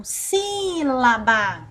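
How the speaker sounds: SÍ of "sílaba" held long, then falling; stretched SÍ. Shown as a demonstration. Teacher shows the class the accented syllable, SÍ stretched. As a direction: draw out the first syllable of "sílaba". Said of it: "nós ficamos mais tempo falando sílaba".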